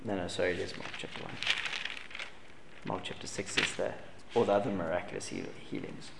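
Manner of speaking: quiet aside while looking up the verse; muttering to himself. Real, not acted. Preacher searching his Bible, mutters the reference quietly. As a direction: mumble it under your breath, half to yourself.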